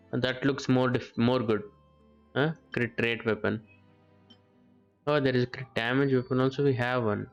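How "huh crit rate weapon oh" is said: ordinary talk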